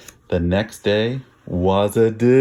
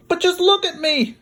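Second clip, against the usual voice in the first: put-on high, bright girl's plea; a girl begging someone to look at her. Little girl to her mother, high and pleading.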